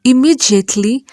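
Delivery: ordinary delivery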